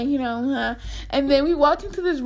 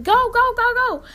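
much higher, pushy urging from behind; imitating her sister hurrying them along; 10 semitones higher, 3 dB louder than usual. Sister behind them in the haunted house, urging them forward, higher. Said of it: "my sister and the other guy behind us was like".

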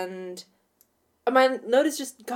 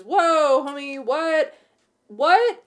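startled dude; 5 semitones higher, 5 dB louder, much slower than usual. Retold or imitated. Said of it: "And he goes".

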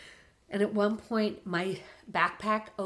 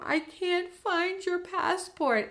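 high, slow mock scream across the terminal; retold scream; 12 semitones higher, 4 dB louder, slower than usual. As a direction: stretch it out high as if screaming to him from far away. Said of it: "I'm screaming to him".

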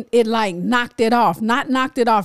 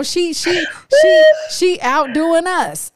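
amazed outcry; 6 semitones higher, slower than usual. Real, not acted. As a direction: shout in disbelief she's outlasting us. Amazed mom outlasts kids.

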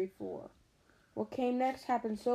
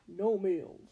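strangled voice. Acted. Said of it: "so angry he could hardly speak".

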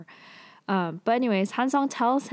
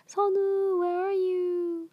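much higher, drawn-out call across the academy; 12 semitones higher, slower than usual. Retold or imitated. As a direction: call out for a missing friend. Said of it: "He's, like, yelling".

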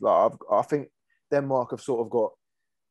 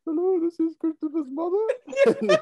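very high, slow put-on mum voice; squeaky mock-mother falsetto, half laughing; kid posing as mother; slower than usual. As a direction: imitate a kid faking his mother on the phone. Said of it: "he puts on the verse".

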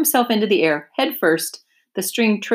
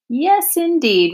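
much higher, slow oily rat voice; sly rat; 10 semitones higher, 4 dB louder, slower than usual. Acted. Templeton agreeing to lend Wilbur string, high and slow.

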